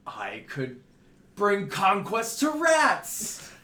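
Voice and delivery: slow build into a loud, high cry; barbarian battle boast. Acted. Shrunken barbarian vowing war on rats, grandly shouted. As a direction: start level, then bellow it like a conqueror. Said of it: "And he's like".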